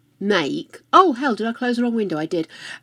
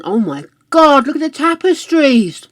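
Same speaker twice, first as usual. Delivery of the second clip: excited; 5 semitones higher, 9 dB louder than usual. Real, not acted. Thrilled by tapestries.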